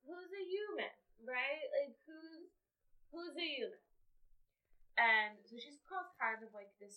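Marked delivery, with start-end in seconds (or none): 0.0-3.8 higher, lightly tipsy questioning; a hint of drunken confusion; drunk woman. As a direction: ask it like a rambling drunk. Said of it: "she's drunk as fucking stupid".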